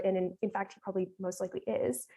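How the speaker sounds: ordinary talk, nothing marked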